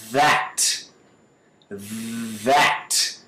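"That" opening on a long buzz; vibrating TH. Shown as a demonstration. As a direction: draw out the voiced TH in "That". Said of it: "Olha como é que vibra".

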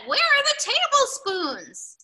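high, loud, slow question; searching iguana character. A narrator's reading. Reader voices Iguana looking under the table, calling out. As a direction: call out as a puzzled storybook searcher.

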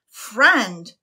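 loud, scornful disbelief; captain mocking her word for the crow; incredulous. A narrator's reading. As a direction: spit back the word in disbelief. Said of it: "captain asked incredulously".

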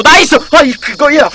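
high, loud burst of triumph; excited cheer. Real, not acted. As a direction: celebrate your teammate's move. Cheers a successful move in game.

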